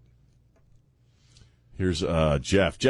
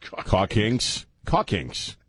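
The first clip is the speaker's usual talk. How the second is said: ordinary talk, nothing marked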